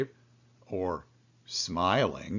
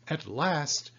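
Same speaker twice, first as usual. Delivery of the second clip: plain reading voice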